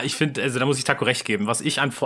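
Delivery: normal talking voice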